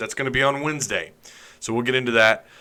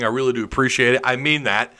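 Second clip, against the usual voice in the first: usual speaking voice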